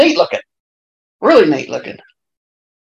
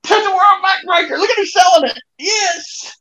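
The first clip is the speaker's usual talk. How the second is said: excited shout; 11 semitones higher than usual. Real, not acted. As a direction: yell it out at the big move. Excited at a big wrestling move.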